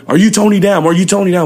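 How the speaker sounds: high, loud repeated demand; retold officer demand. Officers pressing Tony for his name, loud and high.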